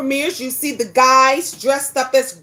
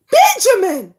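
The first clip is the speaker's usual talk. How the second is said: loud scolding call of his name; shocked mom call; 5 semitones higher, 5 dB louder than usual. Retold or imitated. Mom calling out her son in shock, loud. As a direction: call out Benjamin, caught off guard. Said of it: "and I said".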